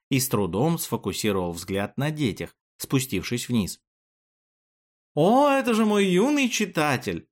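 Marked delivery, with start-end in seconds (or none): 5.1-7.3 high-pitched excited greeting; delighted exclamation. A narrator's reading. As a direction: exclaim high, as if thrilled to see a young fan. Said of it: "воскликнул Юлиус". (радостное восклицание высоким голосом)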